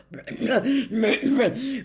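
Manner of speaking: loud forced ahem; loud throat clearing. A narrator's reading. Minister ending his joke with a throat clear. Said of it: "cleared his throat loudly".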